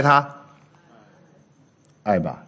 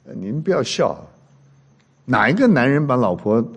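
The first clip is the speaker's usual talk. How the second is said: usual speaking voice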